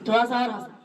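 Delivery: ordinary talk, nothing marked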